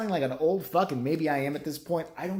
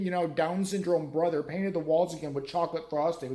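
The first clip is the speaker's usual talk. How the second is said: ordinary talk, nothing marked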